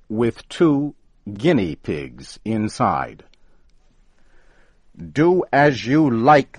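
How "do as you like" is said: loud, high angry shout. Acted. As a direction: bark the order at him. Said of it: "shouted Flannery".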